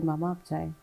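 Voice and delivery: quietly, as if heard from far away in the ear; mother recalls her late daughter's soft voice at the graveyard; soft slow voice. Retold or imitated. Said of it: "سلو سی آواز آئی، آہستہ سی آواز".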